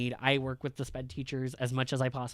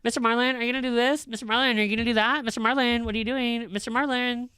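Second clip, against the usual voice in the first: put-on high nagging kid voice, way up in pitch; mock pestering student, high and insistent; whiny student; 8 semitones higher, 4 dB louder, faster than usual. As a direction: whine the name over and over like a pestering teenager. Teacher mocking students pestering him.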